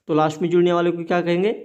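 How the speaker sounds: rattled off fast, a teacher's routine prompt; quick quiz question. Real, not acted. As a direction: fire the question off quickly to the class. Teacher quizzing students, rushed question. (तेज़ी से पूछा गया सवाल)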